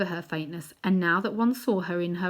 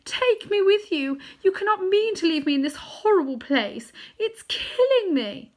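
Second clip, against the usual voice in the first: pleading witch; 12 semitones higher, 5 dB louder than usual. A narrator's reading. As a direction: beg to be taken along, dying. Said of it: "cried the witch".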